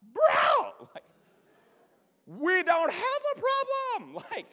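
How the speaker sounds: 高声慌张地辩解; high, loud, slow flustered protest; high pleading. Retold or imitated. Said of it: "And I'm like".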